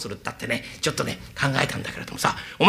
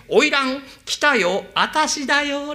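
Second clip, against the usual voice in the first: higher, slow, sweet call; imitating the young master; 9 semitones higher, much slower than usual. Zen mimicking the young master calling the courtesan. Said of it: "あなたの声色と思ってね".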